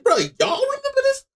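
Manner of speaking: very high-pitched incredulous question; mock disbelief. Friend playing Ichigo to his pals, mock disbelief. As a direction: squeak it out as a baffled character.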